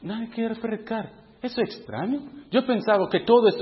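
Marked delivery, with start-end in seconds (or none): 0.0-1.1 softer, bewildered thought; soft puzzled thought, a touch higher; musing to himself. Retold or imitated. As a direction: ask it quietly inside your head. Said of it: "yo mismo pensando".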